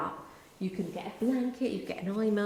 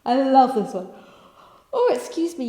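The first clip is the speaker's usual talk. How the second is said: yawning; 5 semitones higher, 10 dB louder than usual. Real, not acted.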